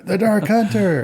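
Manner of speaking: high-pitched cry of alarm, not full volume; acted scream. Player screams a warning at the patient, acted scream. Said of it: "I'll scream".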